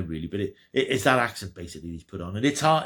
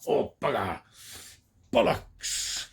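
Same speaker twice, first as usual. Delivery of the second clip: mock English swearing; 4 semitones higher, slower than usual. Reviewer mocking the American idea of English talk, hammed-up put-on swearing. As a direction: spoof the cliché Brit cursing in a put-on voice. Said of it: "we all talk like that".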